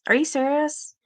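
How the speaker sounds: real disbelief at a rude commenter; incredulous. Real, not acted. Reacting to offensive comment.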